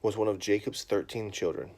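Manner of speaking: put-on loud, lively storybook reading to a child; reading to children. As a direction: read it big and animated for the kids. Reads a children's Bible story.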